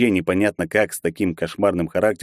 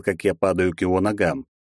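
ordinary delivery, nothing marked

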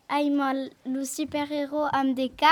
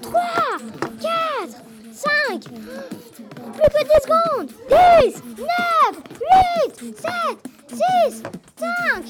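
doing push ups; 11 semitones higher, 4 dB louder, much slower than usual. Acted. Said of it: "Moi, faire des pompes".

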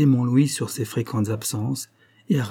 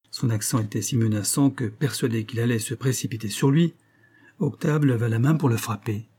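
ordinary delivery

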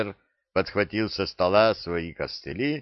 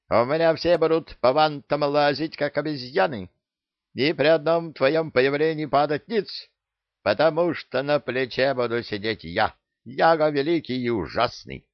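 bossy parrot; 8 semitones higher than usual. Acted. Parrot sets demands, high voice.

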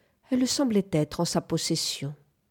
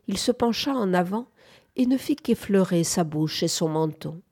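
usual speaking voice

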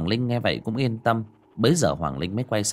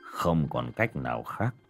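soft, slow, heavy-hearted; resigned sigh; 7 semitones lower, 6 dB quieter, slower than usual. Acted. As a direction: sigh it out, giving up. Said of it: "Trần Lão Đạo thở dài".

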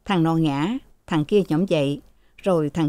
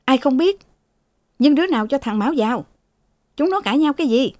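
higher-pitched testy retort; cross, raised-pitch questioning; irritable snapping; 7 semitones higher, faster than usual. Acted. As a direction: snap back impatiently at the servant. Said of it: "Thừa gắt".

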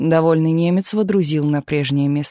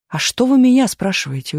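higher-pitched, puzzled question; surprised; 6 semitones higher, 3 dB louder than usual. A narrator's reading. Tumanov asks the sergeant why he decides, surprised. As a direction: toss it back in surprise. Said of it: "удивился Туманов".

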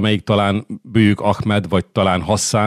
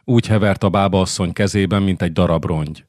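ordinary delivery

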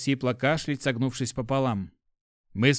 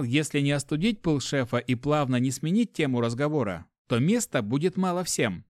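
usual speaking voice

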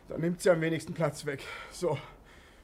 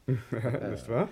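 low laughing question; shared amused laugh; 7 semitones lower, 3 dB quieter than usual. Acted. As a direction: chuckle the question back. Both laughing at the likeness.

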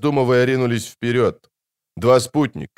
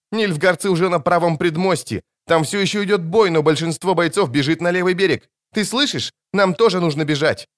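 high, agitated bard voice; panicked urging; 7 semitones higher than usual. A narrator's reading. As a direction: urge them to flee, pitched high. (встревоженно, высоким голосом)

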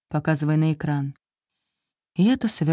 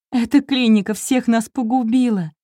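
sobbing; 6 semitones higher, 3 dB louder than usual. A narrator's reading. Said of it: "Эмма разрыдалась".

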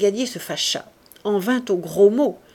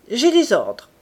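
curt, louder reply; rougher voice; 4 semitones higher, 7 dB louder than usual. A narrator's reading. Commissaire cutting off the wife's pleas, curt and rough. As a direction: cut her short harshly. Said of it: "répondit-il d'une voix plus rude".

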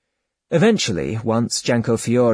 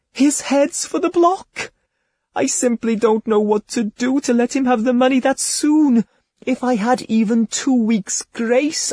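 higher, pleading lady's voice, laid on thick; tearful feigned despair; 9 semitones higher than usual. Acted. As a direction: beg him as if your brother's life hangs on it. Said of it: "she cried".

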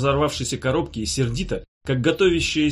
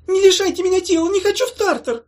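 very high, loud wailing moan; terrified box moaning; moaning creature; 7 dB louder than usual. Acted. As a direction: wail in dread like a trapped little creature.

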